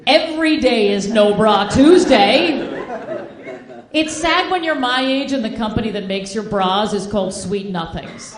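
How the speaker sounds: ordinary talk, nothing marked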